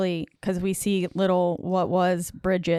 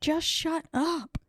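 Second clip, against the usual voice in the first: higher, slow, drawn-out mock outburst; retold exasperation; 10 semitones higher, 3 dB quieter, slower than usual. Imitating the heroine silencing the taunting deadite, exasperated. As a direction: stretch it out high, without extra volume. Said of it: "She was like".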